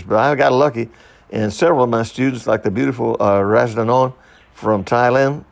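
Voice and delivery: ordinary talk, nothing marked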